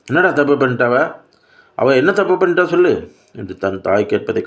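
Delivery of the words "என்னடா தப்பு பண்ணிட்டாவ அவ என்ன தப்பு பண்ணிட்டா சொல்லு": high-pitched indignant questioning, speeding up; angry demand. A narrator's reading. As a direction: demand an answer in rising fury. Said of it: "ஆத்திரத்துடன் கேட்டாள்". (ஆத்திரத்துடன் உயர்ந்த குரலில் கேள்வி)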